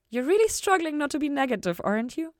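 Ella teasing Lucifer about God, playful. Acted. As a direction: poke fun at his struggle.

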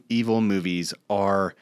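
normal talking voice

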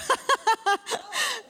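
falling high laugh. Real, not acted. Pastor laughing after her joke, high and falling. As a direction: laugh at your own joke.

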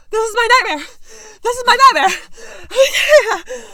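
high, loud, slow panic; panicked wail. Retold or imitated. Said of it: "I was like".

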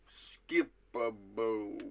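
slow, drawn-out nonsense phrase; recreated odd utterance. Retold or imitated. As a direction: stretch out every syllable, holding the last. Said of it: "give it to you guys one more time".

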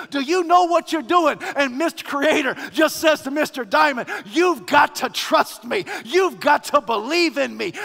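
loud, driving sermon delivery; full-force preaching. Acted. Preacher voicing Creator and Mister Diamond to the congregation, full-force preaching. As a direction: preach it with mounting urgency.

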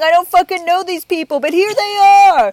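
very loud, high hammed-up DJ announcement; mock emcee belting it out to the room; wedding DJ announcer. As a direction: parody a hired host, shouting, stretched ending. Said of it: "some stranger to go".

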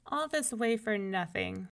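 usual speaking voice

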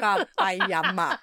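slow deadpan robot name. Shown as a demonstration. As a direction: intone it like a machine. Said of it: "the look on your face when you do it".

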